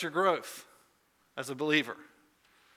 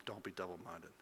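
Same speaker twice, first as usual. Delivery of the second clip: much softer, lower reading of the line; soft earnest close; 7 semitones lower, 18 dB quieter than usual. Retold or imitated. Pastor reads James's words to the congregation: soft, low and earnest. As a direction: let it land gently and seriously.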